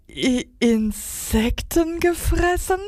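high, drawn-out stammer of disbelief; incredulous high stutter. A narrator's reading. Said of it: "stotterte einer".

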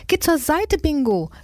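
high urgent call to the dog; mother calls the dog off the path, high urgent call. A narrator's reading. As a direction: call the dog aside in a hurry. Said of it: "rief Mama".

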